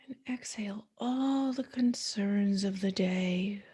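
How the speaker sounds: soothing guided meditation voice. Acted. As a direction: ease the listener into stillness, one breath cue at a time.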